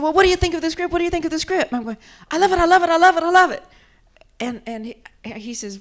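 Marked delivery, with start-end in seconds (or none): 0.0-1.7 high, eager director asking; lightly voiced eager Quentin. Quentin eagerly asks about script. 2.3-3.6 high, loud rapturous repeats; excited I love it, over and over; gushing excitement. Retold or imitated. Gushing reply to Quentin.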